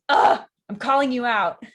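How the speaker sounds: loud exasperated groan into words; fed up. Acted. Juliet has had enough of the nurse, exasperated. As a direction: drop the sweetness and snap loudly. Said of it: "Then she just goes".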